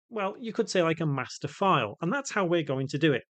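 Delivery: ordinary delivery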